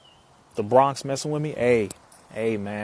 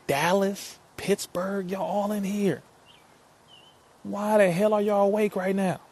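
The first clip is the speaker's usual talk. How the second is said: surprised and excited; 9 semitones higher than usual. Real, not acted. Surprised by late-night viewers.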